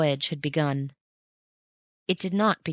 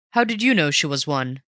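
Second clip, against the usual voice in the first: a touch louder, eager cry; 4 dB louder than usual. A narrator's reading. Raffles catching Bunny out, a mild eager cry. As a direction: pounce on the slip. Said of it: "he cried".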